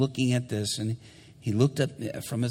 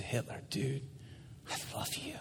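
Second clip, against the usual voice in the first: tender whisper; 3 semitones higher, 12 dB quieter than usual. Retold or imitated. Preacher's tender reply.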